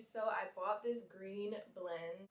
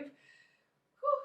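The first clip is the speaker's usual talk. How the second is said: high whew; 6 dB louder than usual. Real, not acted. Reacting to early wake-up.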